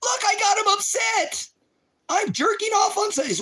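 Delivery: high, loud, sing-song taunting; gloating troll voice. Pretend. Telling viewers he got David upset, a high loud gloating taunt. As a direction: crow over the win in a shrill taunt. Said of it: "Now I'm gonna troll".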